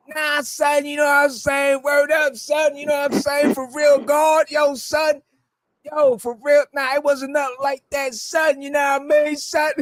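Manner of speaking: high-pitched Wu-Tang slang impression. Host retelling rapper's denial, high-pitched slangy impression. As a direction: imitate a New York rapper, voice held way up high. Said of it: "this dude gave me the whole Wu-Tangian sling slang".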